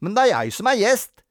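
put-on high, loud indignant child; aggrieved boy's objection; protesting. A narrator's reading. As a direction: protest that it is unfair. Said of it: "protesterte Robin".

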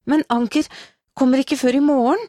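high, urgent protest; crying out. A narrator's reading. Woman objecting that Anker is away, crying out. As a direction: call out in protest, pitch well up. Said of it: "ropte hun".

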